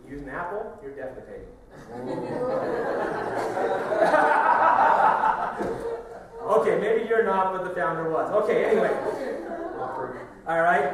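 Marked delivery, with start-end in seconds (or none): 1.5-6.4 long loud burst of laughter. Real, not acted. Laughing at own joke. 6.5-10.9 loud, laughing through words; laughing while talking. Real, not acted.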